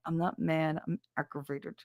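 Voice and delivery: quiet, tight-lipped denial; soft pursed-mouth grumble; lips barely moving. Retold or imitated. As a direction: mutter it through puckered lips. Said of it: "you're not moving your lips".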